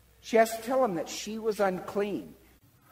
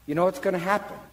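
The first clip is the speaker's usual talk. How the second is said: ordinary delivery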